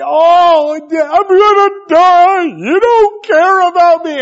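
high, very loud, drawn-out mock wailing; hammed-up slow, loud lament; passionate child's tantrum. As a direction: imitate a kid wailing melodramatically. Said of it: "he's very passionate".

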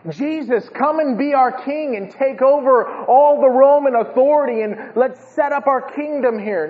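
high, rousing appeal; rally excitedly for a new king; eager followers. Acted. Mimics crowd wanting a king.

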